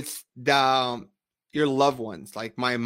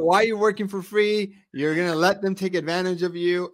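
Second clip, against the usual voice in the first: higher, louder scolding mother; mom's Spanish voice; 5 semitones higher, 4 dB louder than usual. Retold or imitated. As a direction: scold him like a worried mom. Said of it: "in your Spanish voice".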